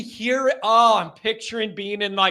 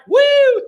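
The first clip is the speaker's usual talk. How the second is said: high, loud "woo!"; excited whoop; 9 dB louder than usual. Acted. Host re-enacting Pat's egg tricks, excited whoop. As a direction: whoop like a showy flip just landed. Mimes Pat flipping eggs.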